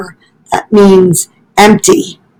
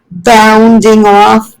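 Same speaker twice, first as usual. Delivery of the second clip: usual speaking voice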